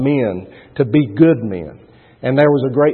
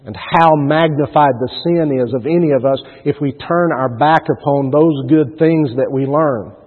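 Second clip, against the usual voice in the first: ordinary talk, nothing marked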